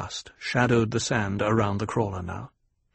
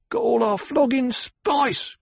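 much higher, slow, resentful grumble of a rough miner; bitter growl; 13 semitones higher than usual. A narrator's reading. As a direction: spit out the loss, slowly and sourly. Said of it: "growled another".

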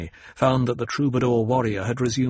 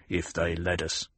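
a low, soft grumble of doubt; growled; 5 semitones lower, 7 dB quieter than usual. A narrator's reading. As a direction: grumble it under the breath, lower.

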